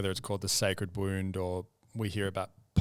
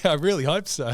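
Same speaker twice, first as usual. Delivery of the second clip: laughing, higher and louder; 9 semitones higher, 9 dB louder than usual. Real, not acted. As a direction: laugh it out, delighted.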